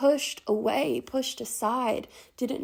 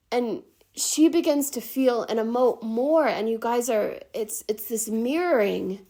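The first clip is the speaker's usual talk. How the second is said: ordinary delivery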